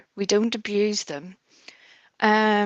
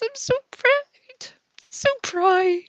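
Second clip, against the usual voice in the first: proud; 14 semitones higher than usual. Real, not acted. Proud of viewers' wee hearts.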